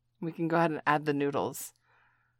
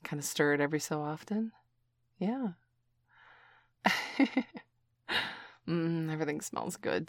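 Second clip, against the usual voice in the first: ordinary talk, nothing marked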